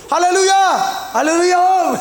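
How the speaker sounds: high, hammed-up hallelujah call; mock cry of an excitable patient; mad congregant. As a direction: call out like an asylum heckler, pitched high. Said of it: "Waktu saya khotbah di tempat orang gila".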